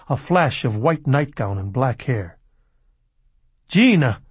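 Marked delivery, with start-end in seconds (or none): 3.7-4.2 higher, louder call of alarm; crying out. A narrator's reading. Samuel Lemke calls to Gina as she steps out, crying out. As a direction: cry out the name sharply. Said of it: "Samuel Lemke cried out".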